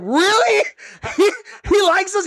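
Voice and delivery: high, incredulous, laughing; can't believe what you just heard; mock-astonished squeak with a laugh; stunned disbelief. Disbelief that Tony liked them.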